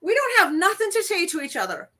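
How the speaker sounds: high-pitched dismissive movie dame; hammed-up haughty refusal; Mary Catherine Deneen. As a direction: brush her off, in character. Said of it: "goes to talk to Mary".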